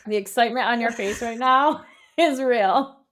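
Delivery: amused, laughing through the words. Real, not acted. Host teasing co-host about her excitement, laughing.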